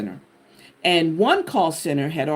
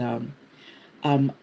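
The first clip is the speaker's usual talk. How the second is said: normal talking voice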